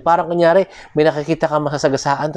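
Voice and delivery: usual speaking voice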